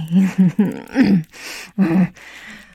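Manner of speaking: long, loud teasing chuckle; indulgent chuckle. Acted. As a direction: laugh fondly at a flustered lover. Teasing reassurance to the partner.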